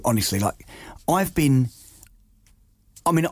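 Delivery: ordinary talk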